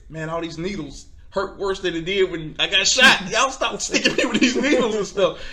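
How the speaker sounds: high, loud comic protest; retold mad complaint. Other shot guy to paramedics sticking needles, mad. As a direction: imitate his outraged yelling, climbing to a near laugh. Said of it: "he got mad 'cause he was like".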